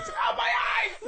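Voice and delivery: high mock shriek of disgust; mock anguished cry. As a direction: cry out in fake pain at the sight.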